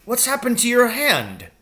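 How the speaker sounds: high, worried question; alarmed father. A narrator's reading. Father alarmed at his son's injured hand, high. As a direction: ask in sudden alarm.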